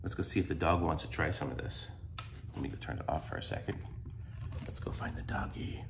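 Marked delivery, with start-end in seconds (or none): 4.7-5.8 whispering. Real, not acted. Whispering while searching for dog.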